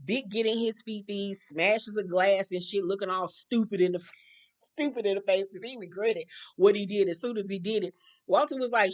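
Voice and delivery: usual speaking voice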